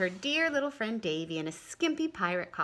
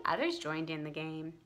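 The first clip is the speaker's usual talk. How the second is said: ordinary delivery, nothing marked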